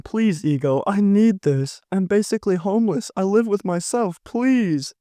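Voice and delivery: high, whiny begging voice; whiny pleading. Retold or imitated. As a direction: beg desperately in a high whine. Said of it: "Bro really pulled up talking about some".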